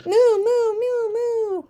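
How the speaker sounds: high playful moo. Pretend. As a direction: moo like a cow for kids. Imitating a cow.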